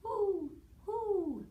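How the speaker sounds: high hooting owl call, hoo hoo. Retold or imitated. Teacher hooting like a snowy owl for children. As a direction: hoot like an owl for the kids. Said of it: "And it says".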